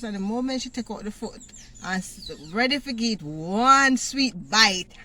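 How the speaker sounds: ordinary delivery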